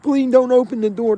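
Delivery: high, frightened begging; pleading scared voice. Retold or imitated. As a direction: beg them not to open it. Cellmate begging guards.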